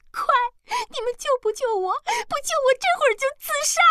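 very high, tearful wail; crying. A narrator's reading. Girl begs the men to save her, crying shrilly. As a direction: sob out a desperate threat. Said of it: "哭道".

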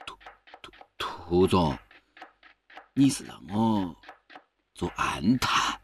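slow, halting stammer of disbelief; hesitant stammer. Acted. Worker asked by his boss to spy, hesitant slow stammer. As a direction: stumble over it, dragging out each word.

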